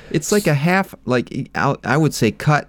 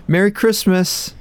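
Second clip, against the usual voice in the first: sheepish apology; 9 semitones higher than usual. Retold or imitated. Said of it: "Ron's just like".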